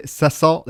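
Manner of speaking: "salt" misread with a stuttered extra syllable, "sesalt"; misspelled word. Retold or imitated. Cook puzzling over the misspelled list, misreading "salt" as "sesalt". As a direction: stumble slowly into "salt" as "s-, sesalt". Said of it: "all the words are misspelled".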